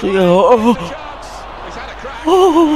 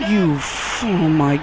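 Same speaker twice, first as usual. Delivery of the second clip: ordinary talk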